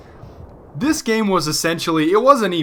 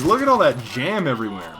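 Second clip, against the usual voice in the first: ordinary talk, nothing marked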